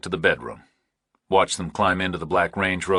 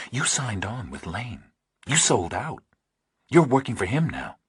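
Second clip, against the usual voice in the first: faintly hostile; 4 semitones higher, 3 dB quieter than usual. A narrator's reading. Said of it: "Pauling was quiet and faintly hostile".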